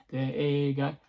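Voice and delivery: normal talking voice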